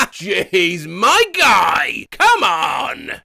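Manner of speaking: loud hyped shouting; excited cheer. Pretend. Narrator cheering on the bath lads, loud and excited. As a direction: whoop it up like a mate cheering.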